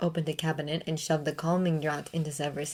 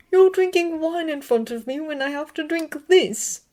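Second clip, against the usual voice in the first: much higher voice, bitter complaint; bitter Remus; 11 semitones higher, 4 dB louder than usual. A narrator's reading. Remus complaining to Severus over wine, bitter. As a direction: say it with a bitter edge. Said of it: "Remus laughed bitterly".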